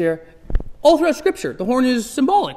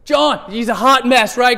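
ordinary delivery

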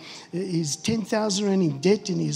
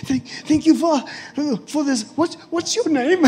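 high, loud, gasping stammer; acted hyperventilating; 7 semitones higher, 5 dB louder than usual. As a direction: play it panicky and out of breath. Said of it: "I'm losing my breath. I'm hyperventilating".

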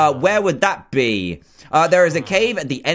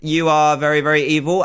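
normal talking voice

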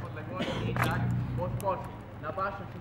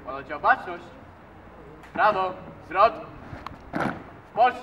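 drill command; 10 dB louder than usual. Real, not acted.

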